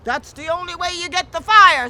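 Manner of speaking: put-on loud, insistent old woman. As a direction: shout the rule at him. Mammy insists.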